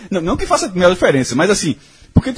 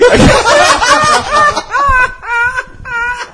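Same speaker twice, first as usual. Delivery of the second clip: a high, loud crying laugh; shrieking laugh; 4 dB louder than usual. Real, not acted. Remembering excusing himself from dinner, shrieking with laughter. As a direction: burst into a wailing shriek of laughter. Recalling asking to leave table.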